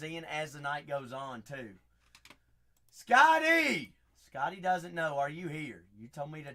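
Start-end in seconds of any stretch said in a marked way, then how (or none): 2.9-3.9 loud high call of a name; loud greeting call. Real, not acted. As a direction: hail him across the room. Calling out to viewer.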